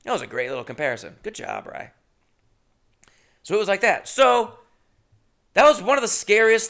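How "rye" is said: ordinary talk, nothing marked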